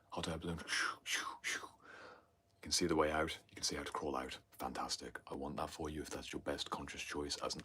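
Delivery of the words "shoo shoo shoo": breathy voiceless shoo, shoo, shoo like air rushing; whooshing shoo sounds. Pretend. Picturing a hot air balloon for viewers, voiceless whooshing shoos. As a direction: blow the shoos out like a balloon burner.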